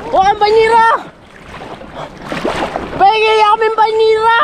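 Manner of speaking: excited high-pitched hollering; breathless, high, carrying shouts; urgent calling out. Real, not acted. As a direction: call across the water for help. Calling companions for the tub.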